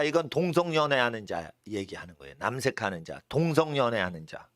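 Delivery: ordinary talk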